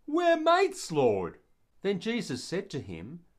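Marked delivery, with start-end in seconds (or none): 0.1-1.4 much higher, louder, chummy and eager; eager Peter. Acted. Peter answering Jesus by the lake, eagerly chummy. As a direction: brush off the question like an old mate. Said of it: "And Peter said".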